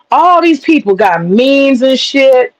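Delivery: ordinary talk, nothing marked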